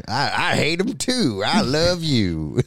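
high, drawn-out mock declaration of love; hammed-up, slow and high sweetheart; mock lovestruck. Acting out dating site catchphrase.

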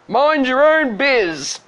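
loud, high, drawn-out retort; snappish prince. Acted. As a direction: bellow it like an imperious prince. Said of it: "the prince replied".

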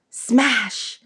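ordinary delivery, nothing marked

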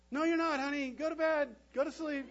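high, loud tired parent calling out; calling back. Acted. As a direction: call through the door to bed. Parent calls back to child.

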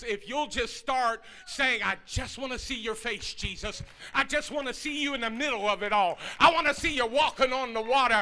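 sermon climax shouted with music; climactic preaching. Acted. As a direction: preach it to the rafters. Preaching at full force.

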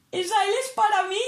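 high, astonished question; can't believe the good news; disbelieving joy. Retold or imitated. Student realising the L is hers, high astonished joy.

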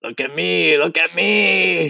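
high, eager calling, stretching the last; excited shout. A narrator's reading. Centipede showing off to others, excited shout. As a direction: crow it out high and thrilled. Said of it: "shouted the centipede excitedly".

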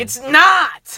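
shouting. Real, not acted. Heated movie argument.

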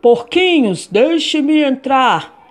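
slow, loud wolf call. Acted. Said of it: "berrava o lobo".

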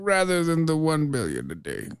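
mock-solemn quote trailing away; deliberately trailing. Co-host finishing a quote on purpose weakly, trailing off. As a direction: let the tail of the quote sink and trail off. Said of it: "you trailed there".

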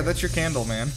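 ordinary talk

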